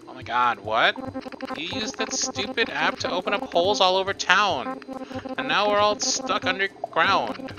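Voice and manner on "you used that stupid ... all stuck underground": high-pitched indignant voice; high, aggrieved character complaint; accusing game character. Acted.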